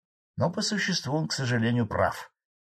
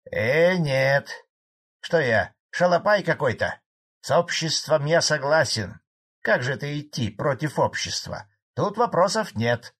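higher, lively peasant protest; protesting old farmer; 5 semitones higher, 4 dB louder than usual. A narrator's reading. Old farmer denies being against the collective, indignant. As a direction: object as if insulted by the idea.

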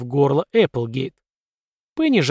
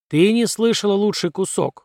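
high excited exclamation; exclaiming; 6 semitones higher than usual. A narrator's reading. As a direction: blurt it out eagerly. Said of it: "воскликнул Ходжес".

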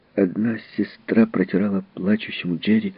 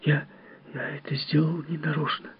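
tearful whisper; 5 semitones higher, 9 dB quieter than usual. A narrator's reading. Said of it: "глаза покраснели, губы дрожали".

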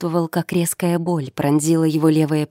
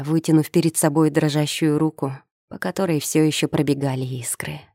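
plain reading voice

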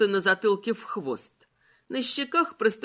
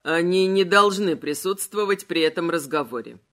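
lower, louder haughty king; skeleton voice; 5 semitones lower, 4 dB louder than usual. Acted. Malachi voiced. (низкий властный голос короля)

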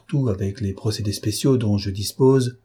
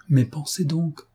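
shivering; 6 semitones higher than usual. A narrator's reading. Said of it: "dit-elle en frissonnant".

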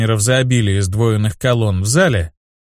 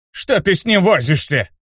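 squeaky gnome; 5 semitones higher than usual. A narrator's reading. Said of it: "пропищал гном-маг".

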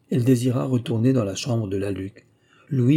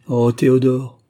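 loud lament on the name; crying out; 2 semitones higher, 7 dB louder than usual. A narrator's reading. Louis naming his doomed friend to Adeline, crying out. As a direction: cry out THÉODORE in distress. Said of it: "s'écria Louis".

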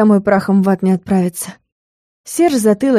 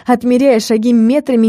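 ordinary delivery, nothing marked

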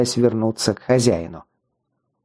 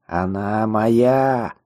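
рокочущий тяжёлый голос; 2 semitones lower, much slower than usual. A narrator's reading. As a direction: rumble it like a heavy-voiced necromancer. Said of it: "рокотал тяжёлый голос". (очень медленный низкий рокочущий голос)

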